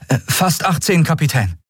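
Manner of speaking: put-on high, hesitant boy's voice; shy boy. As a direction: answer a little unsure. (zögernde Jungenstimme)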